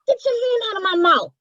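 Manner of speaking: high, loud cry of protest through a hand; muffled protest. Acted. Piggy shoving Dorothy's hand away, yelping high and loud. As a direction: yank the hand off and yelp. Hand pulled from her mouth.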